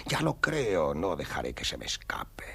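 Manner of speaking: sly hushed aside; scheming whisper. Acted. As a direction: whisper your plan so nobody hears. Hunter's secret aside.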